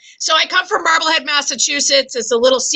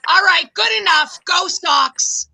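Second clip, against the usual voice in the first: put-on Boston accent; 6 semitones higher than usual. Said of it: "respectful people in business have this Boston accent".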